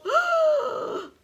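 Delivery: high, loud mock gasp; dramatic gasp. Reader reacts to waterfall, dramatic mock gasp. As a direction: gasp big for a young listener.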